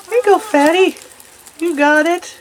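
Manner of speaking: high, sweet pet talk; high cooing encouragement to a chubby rabbit. Real, not acted. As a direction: coax the chubby bunny fondly.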